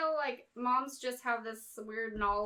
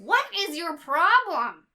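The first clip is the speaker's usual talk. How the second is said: slow, louder, exasperated question; 5 semitones higher, 8 dB louder, slower than usual. Retold or imitated. Imitating herself complaining to her mom, exasperated. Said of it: "And I'm like".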